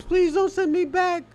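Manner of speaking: high, pleading mock beg; hammed-up desperate plea; mock pleading migrant. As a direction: beg not to be sent back in a squeaky put-on voice. Mocking pleading Africans being deported.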